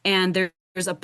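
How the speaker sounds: ordinary talk, nothing marked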